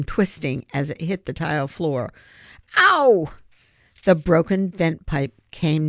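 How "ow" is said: sharp high cry of pain; pained yelp. A narrator's reading. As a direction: yelp as the ankle twists. Ankle twists on landing.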